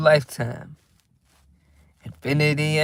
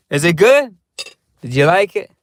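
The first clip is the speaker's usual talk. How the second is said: louder, playful is it good; eager to hear he liked it; excited did you like it; eager question; 3 semitones higher, 6 dB louder than usual. Pretend. Asking after feeding, playful.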